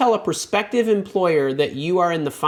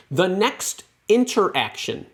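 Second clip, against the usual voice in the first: "interaction" stretched out slowly and raised in pitch; stress on interaction; 4 semitones higher, slower than usual. Real, not acted. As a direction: lean hard on "interaction", drawing it out. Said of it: "I stress the word interaction".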